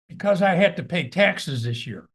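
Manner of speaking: imitating his mother's mad scolding; mother scolding her son over taxes, mock anger; mock angry mom. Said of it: "really mad".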